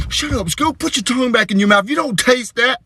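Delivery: high, urgent comic reprimand; appalled scolding. Acted. Dad silencing daughter in restaurant, appalled.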